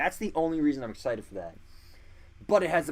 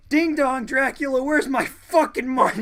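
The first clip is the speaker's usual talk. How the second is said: high, loud unhinged shout; Moon Knight voice; 9 semitones higher, 8 dB louder than usual. Retold or imitated. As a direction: yell it like a deranged vigilante. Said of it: "there's a notorious panel where he's like".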